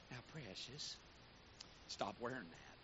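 syrupy Southern lady. Retold or imitated. Bishop's wife gently scolding a young woman, syrupy soft. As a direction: sweeten it then land the rebuke. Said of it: "she'd syrup you up".

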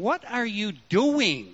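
disappointed father scolding; a touch of a stern God; mild stern rebuke. Retold or imitated. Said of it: "I don't want to hear him saying".

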